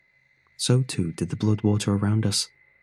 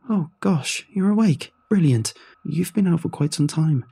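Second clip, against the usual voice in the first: high, bright-eyed surprise, much higher; excited nurse; 8 semitones higher, 3 dB louder than usual. Acted. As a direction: nurse delighted the patient woke, voice jumping high. Nurse sees patient awake.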